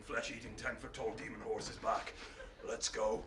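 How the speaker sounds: very low, hushed monotone; deadly quiet movie-avenger growl; menacing threat. Acted. King learns his horses are stolen, quiet menace.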